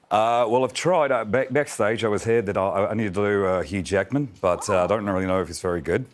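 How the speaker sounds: light, self-deprecating Australian accent; attempted Australian accent. Shown as a demonstration. Guest showing the hosts his shaky attempt at an Aussie accent, Australian accent. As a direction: try a loose Aussie accent. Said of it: "Can you do an Aussie accent?".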